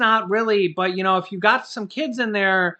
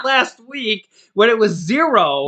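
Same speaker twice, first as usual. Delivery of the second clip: higher and louder, mock-relieved cheer; relieved excitement; 6 semitones higher, 8 dB louder than usual. Host voicing his relief at the checks, excited. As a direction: gush about the better deal. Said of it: "because I'm like".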